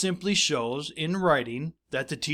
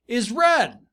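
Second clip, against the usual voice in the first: higher, louder end of quote; emphatic quote; 8 semitones higher, 6 dB louder than usual. Acted. Teacher marking quoted words for students, emphatic. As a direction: finish the quote with lift.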